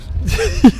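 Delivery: a sudden high laugh that spills out; high burst of laughter. Real, not acted. Interviewer laughing at the guest's rambling apology, high laugh. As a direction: let a quick laugh escape, up high.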